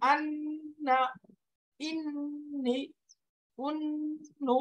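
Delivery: nasal hold on each double n; held ghunnah. Shown as a demonstration. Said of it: "এই যে একটু ধরে রাখলাম".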